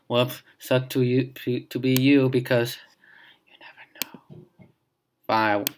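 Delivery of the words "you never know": whisper. Real, not acted. Teasing aside.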